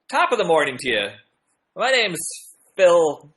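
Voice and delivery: loud, awkward put-on Irish accent. Shown as a demonstration. Vlogger doing an accent on viewers' request, bad Irish accent. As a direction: attempt a hammy Irish accent, a bit too loud.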